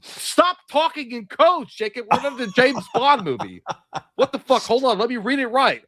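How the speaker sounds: high, mock-scolding rant; mock exasperation. Streamer scolding a viewer's cryptic message, high and exasperated. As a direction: playfully scold the chatter.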